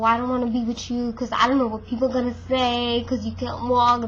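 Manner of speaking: usual speaking voice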